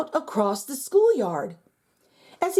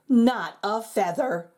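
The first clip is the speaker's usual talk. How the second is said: ordinary delivery, nothing marked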